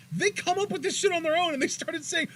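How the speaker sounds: riding the hype of the arena; thrilled. Real, not acted. Telling cohost about the crowd, thrilled.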